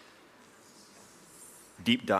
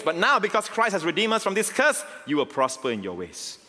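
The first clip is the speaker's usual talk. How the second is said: rising preaching; 10 semitones higher than usual. Acted. Pastor promising his congregation freedom, building triumphant preaching. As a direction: build it to a shout of victory.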